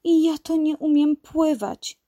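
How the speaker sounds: high, sheepish kitten voice; bashful confession in a high voice; embarrassed little cat. A narrator's reading. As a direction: admit it awkwardly, pitched high. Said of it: "przyznała zakłopotana".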